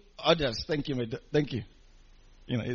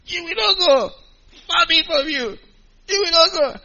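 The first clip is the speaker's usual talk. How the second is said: Peter's rebuke pitched high; high-pitched earnest rebuke; Peter rebuking; 13 semitones higher than usual. Retold or imitated. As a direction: scold Jesus like a bold disciple. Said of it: "he began to rebuke Jesus".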